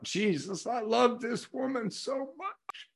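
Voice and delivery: high, soft, drawn-out confession of love; choked-up tenderness. Real, not acted. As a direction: let the voice climb, softly overcome with love. Tearful recalled thought of loving his wife.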